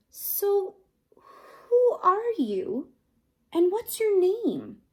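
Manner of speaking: lightly voiced girl asking gently; Eliza's voice. A narrator's reading. Eliza questioning the creature.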